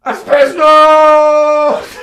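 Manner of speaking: huge high drawn-out bellow; roaring mock toast. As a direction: bellow it joyously like a drunken toast. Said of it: "And he said".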